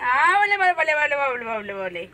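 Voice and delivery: high, loud cooing. Real, not acted. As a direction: coo at the pet loudly. Cooing to pet.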